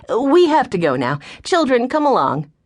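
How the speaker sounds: brisk hurry-up. Acted. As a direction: cut him off and gather the kids.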